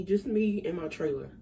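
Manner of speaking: usual speaking voice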